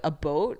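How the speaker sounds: A boat said as a boat, the Canadian about; mock Canadian about. Describing Canadian speech, mild imitation. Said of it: "They go, like".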